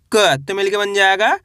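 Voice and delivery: ordinary delivery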